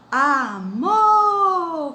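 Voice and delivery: Amor with a long, high, loud mor; exaggerated final stress. Shown as a demonstration. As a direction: call out Amor, swelling and holding the last syllable. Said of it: "com a sílaba mais forte".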